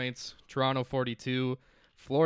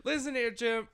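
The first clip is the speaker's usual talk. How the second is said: a hammed-up Southern accent aimed at Jim; Southern Tony Romo; 12 semitones higher than usual. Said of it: "doing Southern for Tony Romo".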